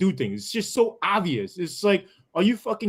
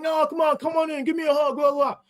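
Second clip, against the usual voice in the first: high mock gushing plea for a hug; fake friendly guy; 11 semitones higher than usual. Coworker insists on a hug, high mocking phony voice. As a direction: mimic a pushy phony pal, high. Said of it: "He's like".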